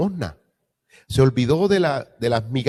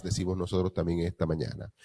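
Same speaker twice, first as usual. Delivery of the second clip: ordinary talk, nothing marked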